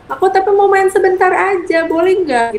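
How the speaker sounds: nada anak kecil yang lebih tinggi dan meminta; suara anak kecil. Retold or imitated. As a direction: adik meminta izin dengan manis. (ibu menirukan adik yang meminta dengan suara tinggi)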